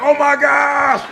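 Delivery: high, loud hammed-up shock; mock horrified disbelief. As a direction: play stunned at your own deed. Acting shock after first murder.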